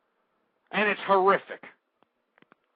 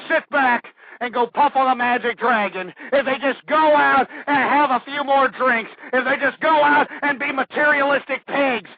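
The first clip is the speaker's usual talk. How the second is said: loud, raised-pitch ranting; angry tirade; 5 semitones higher, 5 dB louder than usual. Real, not acted. Host scorns people who just sit back, angry yelling. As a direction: keep yelling through the list.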